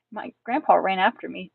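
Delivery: usual speaking voice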